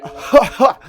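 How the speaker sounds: a wild, high cackle; maniacal laugh. Retold or imitated. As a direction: cackle like a prankster on a tractor. Mate laughing on tractor.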